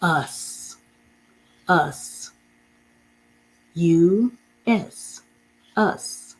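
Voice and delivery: us said clearly, spelt, repeated; modeled word. Shown as a demonstration. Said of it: "The next word is".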